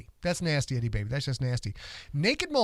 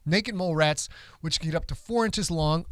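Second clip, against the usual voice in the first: ordinary delivery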